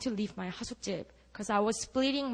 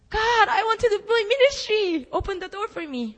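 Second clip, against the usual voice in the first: loud, high desperate plea to God; pleading prayer; 11 semitones higher, 9 dB louder than usual. Retold or imitated. Retelling her prayer to God, pleading loudly. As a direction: cry out to God, begging.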